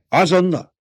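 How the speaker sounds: high, taken-aback question; startled query. A narrator's reading. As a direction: question the order in disbelief. Guard startled by the order. (meghökkent, magas hangú kérdés)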